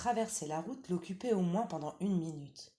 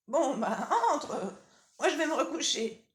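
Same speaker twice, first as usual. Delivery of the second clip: lightly higher, louder granny voice; old grandmother; 10 semitones higher, 7 dB louder than usual. Acted. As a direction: call out as a drowsy old granny from bed.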